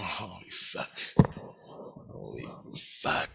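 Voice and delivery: low, hushed disbelief, acted out; stunned muttering. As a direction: sink into a slow, barely audible curse. Retold stunned reaction left standing alone.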